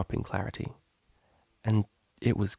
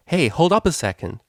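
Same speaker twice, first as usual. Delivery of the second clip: higher, louder shout after her; 7 semitones higher, 5 dB louder than usual. A narrator's reading. Lyra shouts after the departing Octavia, high and loud. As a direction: call after someone walking away. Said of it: "a second shout followed after her".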